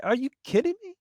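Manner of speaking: put-on a young cop who can't believe no one called; shocked disbelief. Rookie officer hears burglary went unreported, shocked disbelief. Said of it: "I said".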